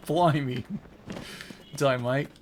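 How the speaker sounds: loud, playful fake Australian; mock Australian. Streamer repeating the joke in a loud mock Australian accent. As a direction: toss off Australian clichés for laughs. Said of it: "saying random Australian stuff".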